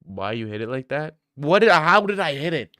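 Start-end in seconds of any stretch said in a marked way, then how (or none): 1.3-2.7 higher, louder defensive outburst; indignant disbelief. Real, not acted. Streamer challenged on how he hits it, indignant. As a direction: throw the question back, affronted.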